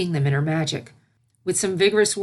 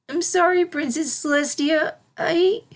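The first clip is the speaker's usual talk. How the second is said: high, slow near-tearful filly; 11 semitones higher than usual. A narrator's reading. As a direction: apologize on the edge of tears. Filly apologizing, near tears.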